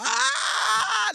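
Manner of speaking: a sudden high yelp of fright, imitating a friend; mock scream. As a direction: shriek it like a scared friend would. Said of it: "Like that, yeah?".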